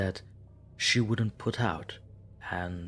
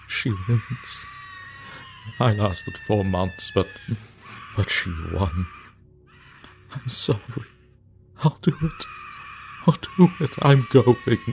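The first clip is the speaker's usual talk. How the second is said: higher, loud sobbing cries; much louder, higher, crying despair; crying shout; 3 semitones higher, 7 dB louder than usual. Acted. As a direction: break down and shout through tears. Man breaking down at final entry.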